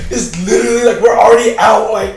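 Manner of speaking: loud, drawn-out student plea; exasperated pleading. Retold or imitated. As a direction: beg loudly, stretching the words. Retelling his plea to the teacher.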